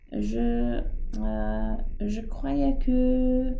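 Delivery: Pip hésite, voix grave puis remontée, lente; bafouiller, coupé dans son élan; balbutiement. Acted. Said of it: "balbutia Pip". (balbutiement embarrassé)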